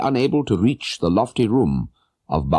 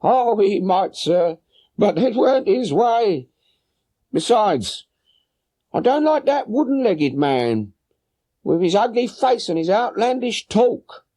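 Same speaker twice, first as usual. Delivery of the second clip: high voiced woman, a little anxious; worried boatman's wife; 13 semitones higher than usual. Boatman's wife telling Holmes her husband is gone, high anxious voice. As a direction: answer the gentleman with a high, fretful woman's voice.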